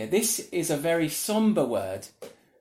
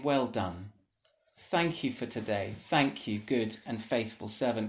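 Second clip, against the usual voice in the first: softer, lower voice of quiet thanks; tender praise; 6 semitones lower, 5 dB quieter than usual. Retold or imitated. Jesus thanking a faithful servant, softly and gently. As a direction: speak it gently, face to face. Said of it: "do you hear his voice saying".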